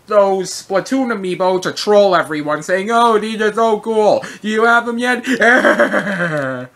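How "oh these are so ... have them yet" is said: mock gushing. Mocking fans with fake excitement.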